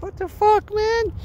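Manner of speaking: high, disbelieving outburst; incredulous. Retold or imitated. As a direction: act stunned at what you see. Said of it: "I'm like".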